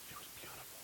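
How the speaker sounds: soft whisper of wonder; whispered awe. Real, not acted. Preacher describing his date on the stairs, hushed whisper. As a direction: breathe it almost silently.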